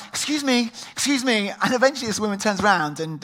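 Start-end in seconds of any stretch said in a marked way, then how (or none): 0.1-1.5 higher, louder calling out; raised mock call after the lady. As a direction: chase someone down the aisle politely. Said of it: "waddling after this lady saying".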